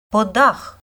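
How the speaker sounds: mildly exclaimed name, rising; exclaimed call. A narrator's reading. Female mavka calls out to Podakh, exclaiming. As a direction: call out to a companion. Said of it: "вигукнув жіночий голос".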